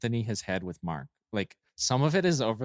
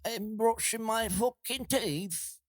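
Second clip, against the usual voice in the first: much higher, slow put-on voice with a strange twang; hammed-up goofy character voice; silly high voice; 10 semitones higher, 4 dB quieter, slower than usual. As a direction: pitch it way up and drag it out. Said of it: "I do voices all the time".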